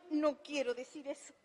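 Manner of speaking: muy bajito, casi inaudible; voz muy baja. Retold or imitated. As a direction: susurra la negativa para que apenas se oiga. (en voz bajísima)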